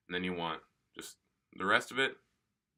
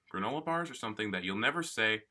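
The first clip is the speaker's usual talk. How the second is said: normal talking voice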